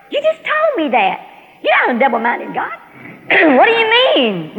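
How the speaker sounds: loud, high-pitched protest; indignant disbelief. Retold or imitated. Woman protests God's sudden no, indignant. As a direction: challenge the answer in disbelief. God has just told her no.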